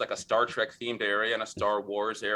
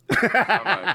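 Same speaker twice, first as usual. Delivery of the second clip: laughing; 9 semitones higher, 10 dB louder than usual. Real, not acted. Laughing at the memory.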